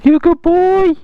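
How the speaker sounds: very high sing-song puppy talk. Real, not acted. Talking to puppy.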